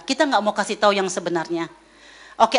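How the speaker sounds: ordinary talk, nothing marked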